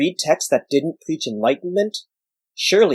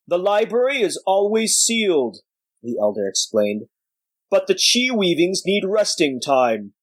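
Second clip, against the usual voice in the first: put-on old sage voice; 6 semitones higher than usual. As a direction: speak like a weary old master. Voices the old sage Bak Fei.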